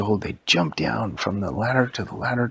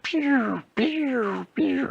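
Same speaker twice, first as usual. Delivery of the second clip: very high, falling laser zaps; imitated laser shots; 13 semitones higher, much slower than usual. Donkey Kong shooting from UFO, imitated laser zaps. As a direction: fire off cartoon laser noises with your voice.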